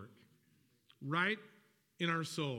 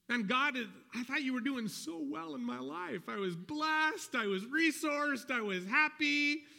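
higher, whining voice of a disappointed believer; complaining believer; 6 semitones higher than usual. Retold or imitated. Believer complaining to God about a pause in life, mock whiny. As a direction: play the puzzled believer who feels let down by God.

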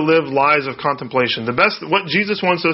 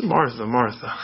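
soft, fond repetition of the name; a hint of loving rebuke; tender; 3 semitones lower, 4 dB quieter than usual. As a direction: say her name twice, gently. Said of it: "I love that".

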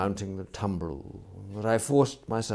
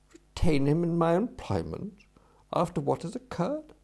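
high, rising, indignant questions; incredulous echo; 9 semitones higher, 3 dB louder than usual. Acted. Employer echoes valet's question about retaining the servant, incredulous. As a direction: throw it back in disbelief.

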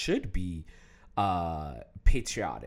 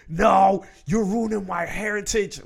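louder, higher mock offended yell; mock jerk outburst; 7 semitones higher, 7 dB louder than usual. Refusing to be a jerk about his name, mock yell. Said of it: "I'm not gonna be a jerk".

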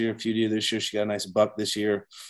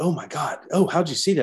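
put-on amazed girlfriend; 7 semitones higher than usual. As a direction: gasp like she can't believe he saw it. Girlfriend amazed he spotted buck.

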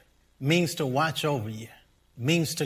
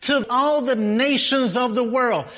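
raised pitch, driving sermon delivery; impassioned preaching; 6 semitones higher, 4 dB louder than usual. Real, not acted. Pastor telling congregation God blessed his people as witness, impassioned preaching. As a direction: preach it with mounting force.

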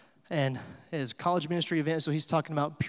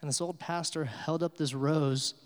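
ordinary delivery

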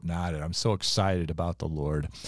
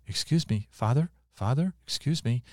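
lightly voiced timid patient, a bit higher; little small voice; 6 semitones higher than usual. Patient behind the curtain calling to the priest, imitated little small voice. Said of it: "I hear a little small voice".

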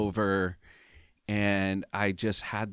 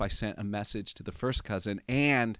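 ordinary talk

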